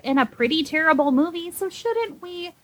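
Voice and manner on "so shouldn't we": higher, slow mock earnestness; dragged-out sarcastic question; sarcastic plea. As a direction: plead it with fake sincerity. Sarcastic case for Glenn Close.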